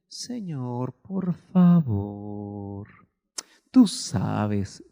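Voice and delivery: low, very slow droning plea; listless mock prayer. Preacher imitating how not to pray for a dying son, droning plea. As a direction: drag the prayer out without urgency. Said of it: "allá no de".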